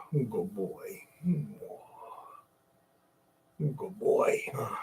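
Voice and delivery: soft, breathy praise for the dog; soft pet whisper. Real, not acted. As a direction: whisper sweet nothings to him.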